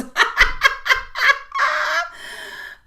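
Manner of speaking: very high, loud cackle; real laughing fit, high and loud; loud laughter. Real, not acted. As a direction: burst into a long, squealing laugh. Laughing about swapping cold weather.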